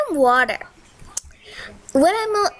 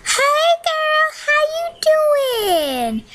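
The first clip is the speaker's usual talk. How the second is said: much higher and slower, sing-song; high, slow greeting; 12 semitones higher, slower than usual. Pretend. Greeting audience in character.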